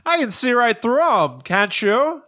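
put-on high, bright-eyed Canadian accent; a Canadian hockey fan marvelling in a Canadian accent. A Whitcomb fan talking to Bob, in a Canadian accent. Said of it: "they are just Canadian".

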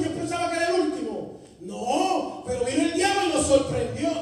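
loud, rising preacher's fire; full-force preaching. Acted. Preacher telling the congregation his testimony, at full force. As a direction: preach it out, building heat.

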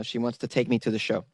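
normal talking voice

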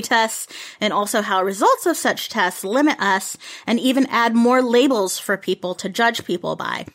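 ordinary talk

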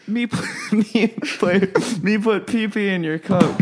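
high, broken-English caricature voice. Retold or imitated. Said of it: "she's like".

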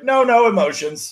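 mock stern rule. Mocking baseball etiquette, slow stern parody. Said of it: "you just gotta be like".

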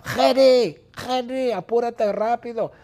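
high, urgent nagging yell; relentless high-pitched impatient shouts; Bertita shouting impatiently. Acted. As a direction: keep hounding a slowpoke to hurry. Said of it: "Bertita grita".

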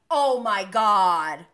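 loud, drawn-out mock exasperation; imitated exasperation. Host imitating Raquel's reaction to Terry, exasperated. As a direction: stretch it out loudly, imitating annoyance.

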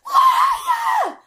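very high, loud screech; piercing drawn-out mock scream; shrieking wife. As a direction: shriek it like an accusing old wife. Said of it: "his wife just runs out. She goes".

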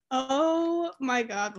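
retold reaction with a long drawn-out "Oh"; starstruck shock. Fans seeing the player leave the dugout, starstruck shock. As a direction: stretch it out slowly in amazement.

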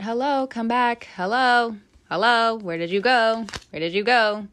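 playful calling out. Pretend. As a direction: call out as if your thought wandered off. Calls after lost train of thought.

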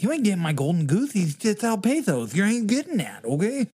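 high, swooping, badly faked actor voice; sing-song bad celebrity impression; Luis Guzmán. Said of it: "I can't do a very good voice of his".

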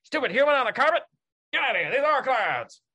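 high, loud, fast furious shout; angry dolphin yell. Acted. Dolphin gang yelling at the intruder, furious shout. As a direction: scream them off the carpet.

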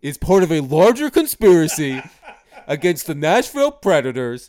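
high, loud conspiracy rant voice; that silly voice. Pretend. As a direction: rant like a paranoid fan. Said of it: "blame the referees in that voice".

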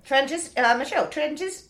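stumbling over a swollen tongue; words catching, thick-tongued. Real, not acted. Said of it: "it's like my tongue is swollen".

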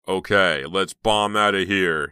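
loud, slow eager call; enthusiastic. Acted. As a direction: say it with gusto, loudly. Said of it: "he said enthusiastically".

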